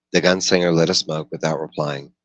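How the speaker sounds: ordinary delivery, nothing marked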